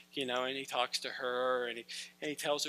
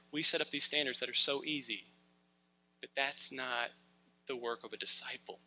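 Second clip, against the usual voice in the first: ordinary delivery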